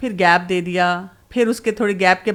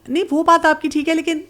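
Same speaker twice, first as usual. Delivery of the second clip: higher, laughing mother's objection; stunned mother objecting with a laugh; mothers' mock protest; 7 semitones higher than usual. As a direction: play the bewildered mother protesting. Mothers protest after being shocked.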